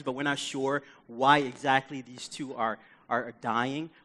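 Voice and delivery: usual speaking voice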